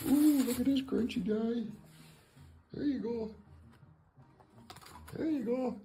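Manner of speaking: high-pitched treat-offering voice; sing-song pet coaxing; coaxing pet talk. Real, not acted. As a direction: tempt an animal with a treat, high and sweet.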